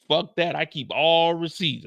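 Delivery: ordinary talk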